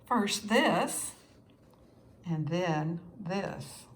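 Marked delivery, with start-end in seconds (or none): none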